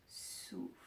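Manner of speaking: very soft, voiceless exhale on the word; breathed-out cue. Shown as a demonstration. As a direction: breathe the word out slowly and softly. (soufflé tout bas, en expirant)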